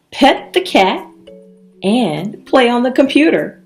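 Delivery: usual speaking voice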